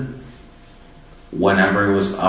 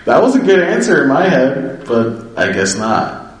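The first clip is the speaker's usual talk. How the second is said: high, louder amused protest; mock indignation; 7 semitones higher, 5 dB louder than usual. Speaker retelling their reaction to the sponsor, mock indignant. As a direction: retell annoyance with a light laugh in it.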